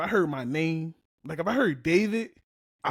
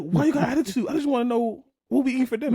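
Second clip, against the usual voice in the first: higher, quick protesting question; exasperated partner; 8 semitones higher, 4 dB louder, faster than usual. Retold or imitated. Wife asking husband about dinner, higher and mildly exasperated. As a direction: lightly mimic a put-upon partner asking innocently. Voicing partner's puzzled reply.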